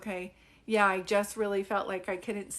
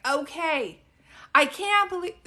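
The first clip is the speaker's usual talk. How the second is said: high, loud blurt; a mom who still won't whisper; 6 semitones higher, 9 dB louder than usual. Retold or imitated. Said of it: "So whisper".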